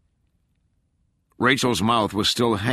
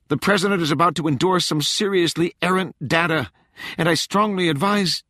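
high, loud, desperate woman; a frantic agent pleading urgently; frantic young woman; 11 semitones higher, 4 dB louder than usual. A narrator's reading.

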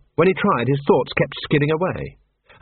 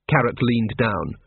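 ordinary delivery, nothing marked

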